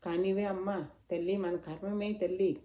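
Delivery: usual speaking voice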